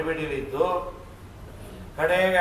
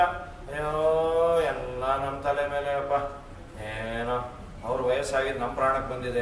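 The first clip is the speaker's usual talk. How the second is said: mock lament; 5 semitones lower, 6 dB quieter than usual. Voices complaining family.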